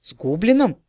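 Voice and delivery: surprised, high question; amazed repeat of the word; astonished. A narrator's reading. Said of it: "изумился Аптелемнар".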